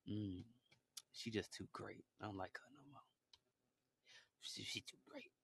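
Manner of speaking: low, hushed jealous murmur, trailing to a whisper. Retold or imitated. Jealous girl whispering about the new star. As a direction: hiss it under your breath, a jealous girl. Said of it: "Her demon coming out right now".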